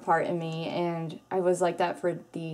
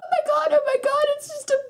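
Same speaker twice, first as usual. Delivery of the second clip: very high, fast panicked squeal; hammed-up hysterical screech; freaking out; 4 dB louder, faster than usual. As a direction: shriek in disgust at the worm ball. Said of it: "because I get really loud".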